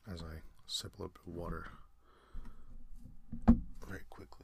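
very soft aside, trailing into a whisper; quiet aside. Real, not acted. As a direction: drop right down, as if off the record. Reader's aside during water break.

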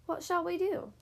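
ordinary delivery

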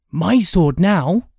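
high, loud, drawn-out claim; gleeful announcement. A narrator's reading. Brother claiming the fallen weapon, announcing gleefully. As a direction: claim it slowly and proudly, high-pitched. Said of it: "he announced".

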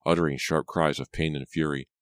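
plain reading voice

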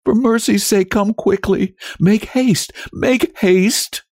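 very high, urgent pleading; desperate wife's entreaty; holding back sobs. A narrator's reading. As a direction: beg for haste, pitched right up. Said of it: "restraining her sobs".

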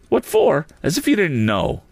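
high-pitched, incredulous retort; indignant blurt. A narrator's reading. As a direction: throw the question back crossly. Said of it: "blurted out the owner".

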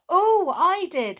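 loud, high burst of remembering; Oh! it comes back to you; suddenly remembering. A narrator's reading.

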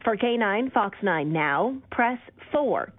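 normal talking voice

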